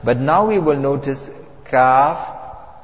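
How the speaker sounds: normal talking voice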